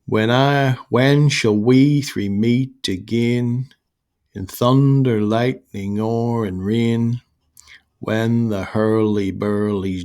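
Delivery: slow, heavily metred verse reading; drawn-out, rhythmic recitation of a witches' verse; trochaic verse recital. Shown as a demonstration. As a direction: lean on the first beat of each foot, slowly. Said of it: "is trochaic meter".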